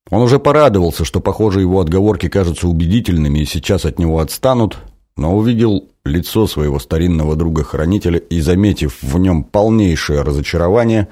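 plain reading voice

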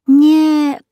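a high, loud and decisive no; the cat overrules the dog with a loud, firm no. Acted. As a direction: shut the doubt down firmly. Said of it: "решительно сказал Кузя".

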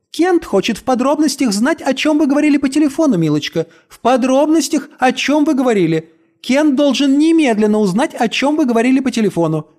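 high, fast eager parroting voice; fussy high echo. A narrator's reading. Brit-Marie echoes Kent shrilly.

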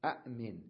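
أأمن with short a, glottal catch, then min; original two hamzas. Shown as a demonstration. Teacher repeating the original form, with catch. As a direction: cut أأمن after the first a. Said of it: "أصلها أأمن".

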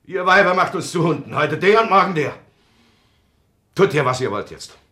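high, loud angry outburst; furious shout. Acted. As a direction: explode at her.

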